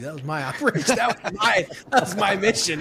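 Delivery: high-pitched indignation breaking into laughter; laughing mock protest. Marine ribbing the sniper who ran his mission, laughing mock protest. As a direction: protest through a laugh, voice climbing.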